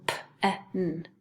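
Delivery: "P-E-N" as three separate letter sounds; sounded out. Shown as a demonstration. Teacher sounding out a second syllable, "P-E-N" sound by sound. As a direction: break "P-E-N" into slow single sounds. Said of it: "second syllable".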